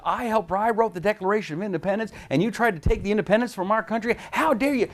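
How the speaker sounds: high indignant founder; outraged Jefferson. Retold or imitated. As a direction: demand how he dares. Jefferson in the joke.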